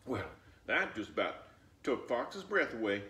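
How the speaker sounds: plain reading voice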